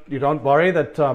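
ordinary delivery

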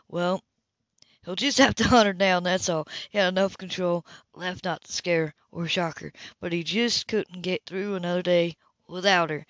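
ordinary delivery, nothing marked

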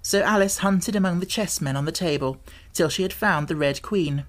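usual speaking voice

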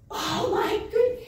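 high, slow stunned exclamation; breath caught in disbelief; astonished gasp. Retold or imitated. Recalling meeting the girl she championed, stunned.

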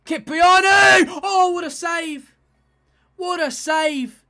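slow, high, loud yell at the near miss; excited shout. Real, not acted. Fan commentating his own game, screaming at a great save. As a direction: roar it like the ball nearly went in.

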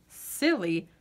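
ordinary delivery, nothing marked

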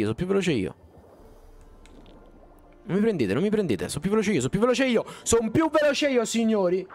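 escaping monsters, taunting them with glee; excited taunting. Real, not acted. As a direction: yell it while escaping. Fleeing enemies, taunting them.

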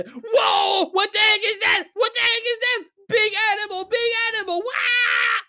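high-pitched, panicked shouting; terrified. Acted. Player spotting a giant beast in a hole, terrified. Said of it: "Look at how terrified he is".

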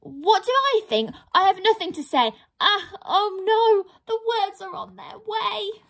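high, fast, breathless panic; panicking pixie. Acted. Pixie panics as the truth forces its way out, high and fast. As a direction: try to stop the words and fail.

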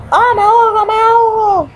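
high, loud panic: I'm drowning; panicked gasping cry. Real, not acted. Gamer panicking as his character drowns underwater. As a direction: yelp it out as the air runs out.

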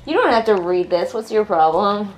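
usual speaking voice

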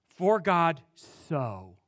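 "For God so" drawn out slowly, each word weighted; slow emphatic reading. Shown as a demonstration. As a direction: linger on "For God so". Preacher dwells on the verse.

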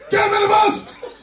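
loud hyped trainer yelling encouragement; trainer shouting. Retold or imitated. Trainer yelling at his client to push harder. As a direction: bark it like a pumped-up gym coach. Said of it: "he go come say".

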